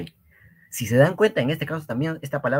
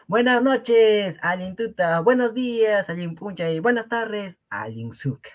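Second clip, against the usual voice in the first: bright greeting; 10 semitones higher, 3 dB louder than usual. Acted. Acting out greetings.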